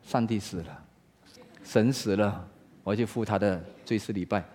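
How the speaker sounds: low, soft, matter-of-fact; composed, hushed announcement; cool and calm. Acted. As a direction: deliver it quietly, without any agitation. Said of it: "卡塔琳娜很冷静地说".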